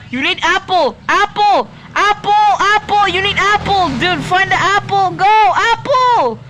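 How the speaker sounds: high loud repeated yells; frantic urging. Real, not acted. Gamer yelling cooking orders at friend, frantic high shouts. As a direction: hurry him with rising panic.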